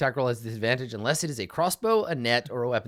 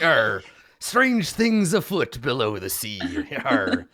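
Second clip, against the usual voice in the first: put-on slow, gruff old seaman; old sailor voice; 4 dB louder, slower than usual. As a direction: growl it like a weathered old sailor. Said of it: "He's like".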